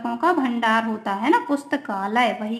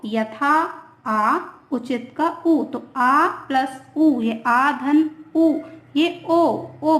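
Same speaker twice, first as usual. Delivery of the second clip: slow syllable breakdown; much slower than usual. Shown as a demonstration. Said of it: "teacher splits the sandhi slowly".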